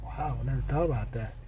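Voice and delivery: lightly voiced customer, low and soft; mock awed customer. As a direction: play the customer who's just been won over. Customer reacts to the pitch.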